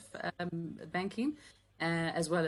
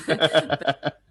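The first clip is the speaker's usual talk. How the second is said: burst of laughter; 10 dB louder than usual. Real, not acted. Guest laughing at her left-brain joke, loud laugh. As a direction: laugh out loud mid-word.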